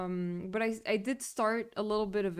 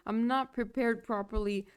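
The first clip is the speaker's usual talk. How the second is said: ordinary talk, nothing marked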